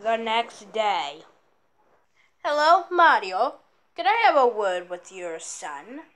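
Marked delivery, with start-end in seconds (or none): none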